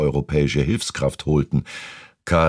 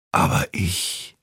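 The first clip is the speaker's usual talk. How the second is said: slow, drawn-out whisper; slow whispered protest; 4 semitones higher, slower than usual. A narrator's reading. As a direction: whisper it slowly, with no voice. Bedridden Barbara whispers again in dialogue.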